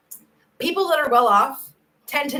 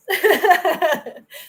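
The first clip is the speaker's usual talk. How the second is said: happy laugh; 8 semitones higher, 4 dB louder than usual. Real, not acted.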